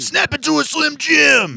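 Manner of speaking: high, loud raspy promo shout; Macho Man voice. Retold or imitated. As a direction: bellow the catchphrase like Randy Savage. Slim Jim catchphrase impression.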